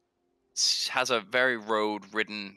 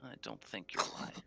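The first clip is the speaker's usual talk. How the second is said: suspicious, hushed halfling; 7 semitones higher, 9 dB quieter than usual. Acted. Halfling leader quietly testing a stranger for lies, hushed and wary. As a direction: quietly probe whether they're lying.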